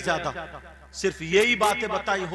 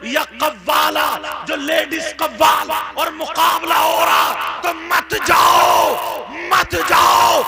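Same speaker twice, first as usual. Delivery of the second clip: loud, high, impassioned sermon shouting; fiery preaching; 7 semitones higher, 9 dB louder than usual. Acted. Preacher thundering at the crowd against sin, loud and high. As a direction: preach it out at full force, pleading.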